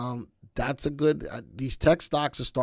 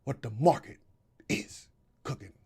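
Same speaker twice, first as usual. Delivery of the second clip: The Rock impression; 5 semitones higher, 7 dB louder, slower than usual. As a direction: drawl it out like The Rock, eyebrow cocked. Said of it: "That was my rock impression".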